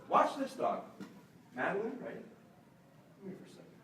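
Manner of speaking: ordinary talk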